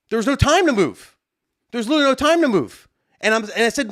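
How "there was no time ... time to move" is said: high-pitched exasperated insistence; worked-up, indignant retelling; getting mad. Retold or imitated. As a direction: argue back, pitch climbing. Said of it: "Now I'm getting more mad".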